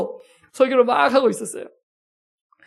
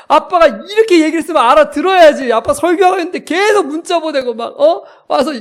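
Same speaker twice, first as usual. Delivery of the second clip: loud, raised-pitch telling-off; shouted scolding; 5 semitones higher, 5 dB louder than usual. Retold or imitated. Father scolding child for constant messages, shouting. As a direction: re-enact an exasperated father laying into his child. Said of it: "소리를 지르면서 아이를 막 혼냈어요".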